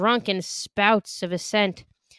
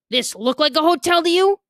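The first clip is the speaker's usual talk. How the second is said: gruff demand; 8 semitones higher, 4 dB louder than usual. A narrator's reading. Bartender confronting narrator.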